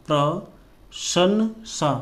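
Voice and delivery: प्रशंसा drawn out very slowly; slow spelled-out प्रशंसा; slow dictation. Shown as a demonstration. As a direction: stretch प्रशंसा syllable by syllable. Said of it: "teacher spells while writing".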